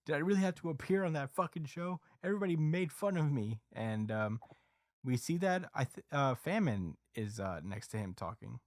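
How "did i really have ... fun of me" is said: higher sulky complaint; a touch of teen frustration; frustrated kid. Retold or imitated. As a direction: whine about being laughed at. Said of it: "is like".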